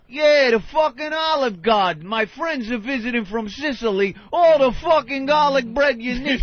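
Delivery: Guido. Retold or imitated. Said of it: "the most Guido motherfucker going".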